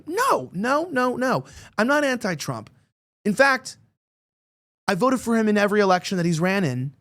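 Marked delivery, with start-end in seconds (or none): 0.1-5.4 higher-pitched, insistent protest; emphatic denial. Retold or imitated. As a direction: protest quickly at a mistaken accusation. Retold denial to an accuser.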